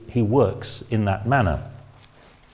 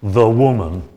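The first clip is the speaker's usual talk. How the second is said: louder, cold and dismissive; scornful, distancing; contemptuous; 8 dB louder than usual. Retold or imitated. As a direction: spit out the words with disdain. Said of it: "he spoke like this".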